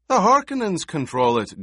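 high, loud protest. A narrator's reading. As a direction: object forcefully, pitched well up. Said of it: "Gurney protested".

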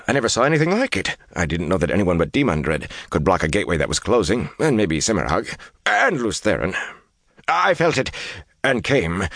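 high, rushed pleading that climbs in pitch; anxious babbling. Acted. Kneeling Asmodean swears loyalty to Rand, babbling and pleading. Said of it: "began to babble".

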